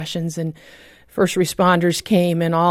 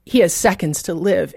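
ordinary talk

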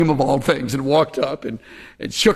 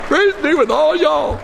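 high, loud, delighted proclamation; gleeful punchline; 13 semitones higher, 5 dB louder than usual. Real, not acted. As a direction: proclaim it gleefully to a laughing audience. Repeats punchline over audience laughter.

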